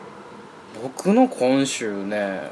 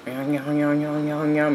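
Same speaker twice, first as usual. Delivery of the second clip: fast mock babble imitating unclear speech; mocking an Indian colleague's unclear talk, fast babble; mock gibberish; 3 semitones higher, faster than usual. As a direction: rattle off nonsense syllables quickly. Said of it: "みたいなね".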